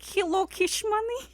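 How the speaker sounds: high-pitched mock Russian accent. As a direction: put on a Russian accent for a prank call. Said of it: "a Russian accent".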